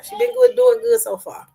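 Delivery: proud praise. Real, not acted. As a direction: gush with pride. Proudly praising her daughter.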